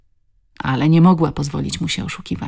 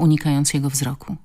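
ordinary delivery, nothing marked

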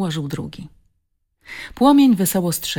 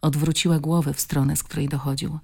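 ordinary delivery, nothing marked

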